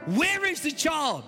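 normal talking voice

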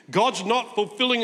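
ordinary delivery

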